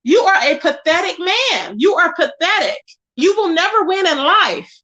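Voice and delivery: usual speaking voice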